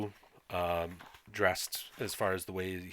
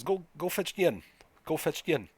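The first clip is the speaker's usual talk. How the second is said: put-on higher-pitched villager sending a child off; urgent villager; 9 semitones higher, 3 dB louder than usual. Dennis tells a gawking child to fetch Yen, urgent higher voice. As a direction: urge the child along in a raised pitch. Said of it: "and says".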